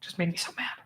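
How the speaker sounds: whispered annoyance; soft whispered complaint. Real, not acted. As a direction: hiss it under your breath. Whispered frustration.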